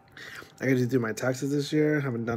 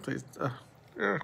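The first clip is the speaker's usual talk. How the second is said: normal talking voice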